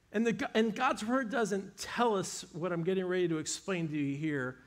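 usual speaking voice

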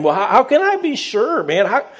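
hammed-up skeptic pushing back at the angel; high mock voice of a doubting old priest; doubting Zechariah. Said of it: "He says these words to him".

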